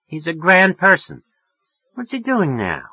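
gushing, high-pitched sociability; friendly as hell. A narrator's reading. Woman asking after a mutual friend, suddenly friendly. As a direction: lift the voice into eager friendliness. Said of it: "She was getting friendly as hell".

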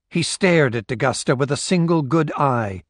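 usual speaking voice

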